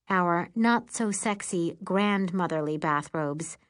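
usual speaking voice

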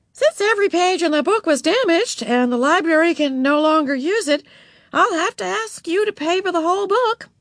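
high-pitched, emphatic official voice; librarian explaining. A narrator's reading. Miss Evans telling Beezus to pay, high and apologetic. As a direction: lay down the rule in a high voice.

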